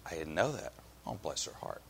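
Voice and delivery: quiet, acted commiseration; sympathetic. Shown as a demonstration. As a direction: sink gently into pity for her. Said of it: "One is sympathy".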